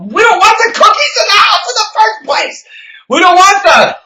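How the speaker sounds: high-pitched, heated protest; impassioned ranting shout. Real, not acted. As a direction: rant at the top of your range. Impassioned rant against junk food.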